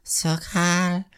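mock baby talk to Kyle; sugary Natasha. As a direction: coax him like a sweetheart. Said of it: "Natasha's like".

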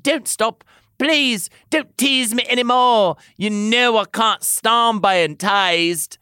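high, drawn-out pleading; moaned plea. A narrator's reading. Tamlin begs the lover to continue, moaning. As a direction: plead urgently, pitch well up. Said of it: "moaned Tamlin".

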